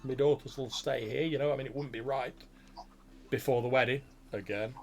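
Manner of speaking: put-on jovial farmer reassuring the young groom; farmer father.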